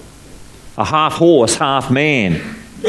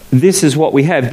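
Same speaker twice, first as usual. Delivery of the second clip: ordinary talk, nothing marked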